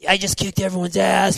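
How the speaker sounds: high, excited victory crow; hyped boast. Retold or imitated. Winner on the podium telling himself, high excited boast. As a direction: crow it like you just won.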